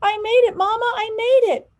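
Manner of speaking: very high, loud joyful cries; shouting for joy. A narrator's reading. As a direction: shout it out to Mama across the lot. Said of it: "Jumping and shouting".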